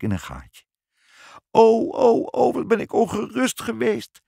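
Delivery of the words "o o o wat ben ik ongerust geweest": very high, wailing relief; sobbing. A narrator's reading. Mother greeting the two returning mice, sobbing. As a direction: sob it out in a high, hammed-up mother's voice. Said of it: "snikte moeder".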